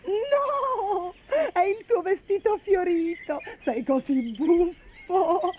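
high, amused, barely holding it in; helpless giggling. Acted. Bastoncello teases Ugo about the dress, high giggling voice. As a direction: say it high, fighting back laughter. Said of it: "rise Bastoncello senza riuscire a frenarsi".

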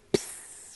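soft unvoiced "pss" like a puff of air; vanishing puff. A narrator's reading. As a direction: breathe out a tiny vanishing puff. Leprechaun vanishes. (soffio sussurrato di sparizione)

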